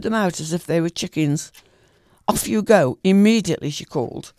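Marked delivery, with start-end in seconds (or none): 2.3-3.7 louder, commanding call; loud, haughty order to go; cold, proud call. A narrator's reading. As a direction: shoo them off like chickens. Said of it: "she called, cold and proud".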